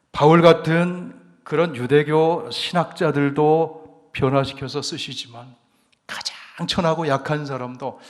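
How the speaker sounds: loud, rising preacher's proclamation; fervent preaching. Acted. Pastor to the congregation on the power of the gospel, loud fervent preaching. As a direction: preach it out to the congregation at full force.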